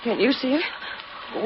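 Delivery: hushed. Acted. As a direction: whisper it slowly, afraid. Wife asks in hush.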